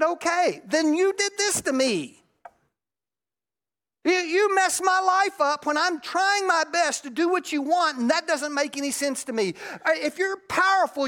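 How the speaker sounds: loud, high, furious cry to God; retold shouting at God, anguished; angry shout at God. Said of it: "God said / So I said".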